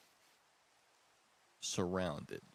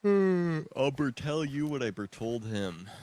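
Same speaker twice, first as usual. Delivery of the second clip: abridged anime quote; 4 semitones higher, 8 dB louder, slower than usual. Pretend. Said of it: "Attack on Titan abridged reference".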